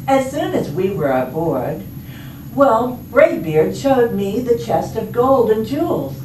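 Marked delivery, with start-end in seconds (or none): none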